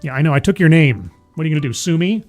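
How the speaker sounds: ordinary talk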